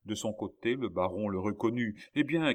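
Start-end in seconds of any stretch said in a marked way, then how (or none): 2.1-2.5 high, loud expectant call. A narrator's reading. Baron spotting his son at daybreak, calling out. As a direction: cry out for news. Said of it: "cria-t-il à Philippe".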